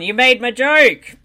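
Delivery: loud and slow. Real, not acted. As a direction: drag it out loudly. Loud slow laughing complaint.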